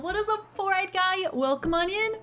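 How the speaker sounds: bright greeting. Real, not acted.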